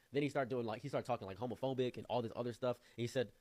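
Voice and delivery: ordinary talk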